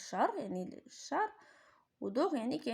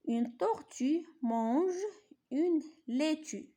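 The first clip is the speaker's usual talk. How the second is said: higher, slow, carefully shaped reading of Une tortue mange une laitue; model reading; 5 semitones higher, much slower than usual. Shown as a demonstration. Teacher modelling Une tortue mange une laitue for pupils, higher and slow. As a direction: lift the voice and stretch each word of une laitue. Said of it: "نقراو هادو قراءة جيدة".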